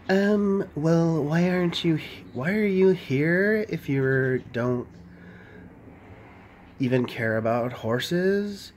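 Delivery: drawn-out, uptalking Valley Girl imitation; mock Valley Girl voice. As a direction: imitate a slow Valley Girl, stretching the words. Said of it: "talks like she's, like, Valley Girl".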